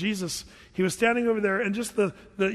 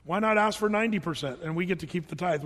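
normal talking voice

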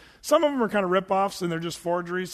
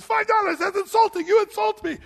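much higher and louder, mock outrage; offended old shopkeeper; 13 semitones higher, 5 dB louder than usual. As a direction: squeal the insult like an indignant old merchant.

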